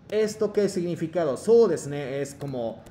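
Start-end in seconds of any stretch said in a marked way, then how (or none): none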